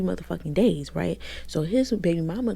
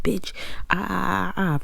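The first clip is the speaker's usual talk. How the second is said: higher, wordless imitation of a tirade; mock cursing-out; 3 semitones higher than usual. Storyteller imitates him walking in and cursing her out, mock tirade. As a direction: mimic someone ranting with nonsense syllables. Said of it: "Cursing her out".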